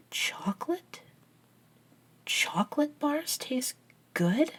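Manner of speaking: incredulous. Acted. Acted disbelief at vampire's food discovery.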